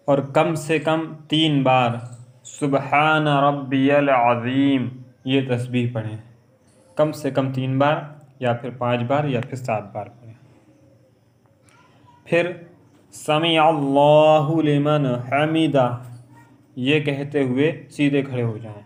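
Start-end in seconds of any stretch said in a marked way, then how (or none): 2.5-4.9 slow, measured recitation. Shown as a demonstration. Teacher showing the bowing tasbih, slow recitation. 13.1-16.0 slow, drawn-out recitation, a little louder. Shown as a demonstration. Teacher demonstrating rising from ruku, slow and drawn out.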